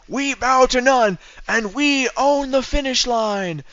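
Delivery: high, slow mock elderly voice; Joe Biden voice. To chat, quotes Biden in a hammed-up elderly voice. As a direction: quote it like a frail old president. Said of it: "it's in his Joe Biden voice".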